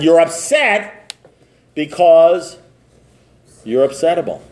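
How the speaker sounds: slow, spaced-out punchline with long pauses; deliberate verdict. Retold or imitated. Sponsor finally telling sponsee the reason, slow and deliberate.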